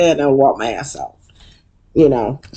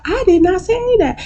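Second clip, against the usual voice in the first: very high squeaky mock denial; Quad denying. Quad denying to Toya, in a very high mock voice. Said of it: "And Quad's like".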